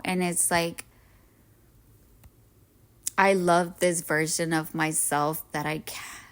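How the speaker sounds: usual speaking voice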